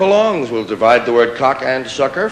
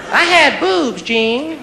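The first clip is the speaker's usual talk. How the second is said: contestant voice; 11 semitones higher, slower than usual. Pretend. Game show contestant quote.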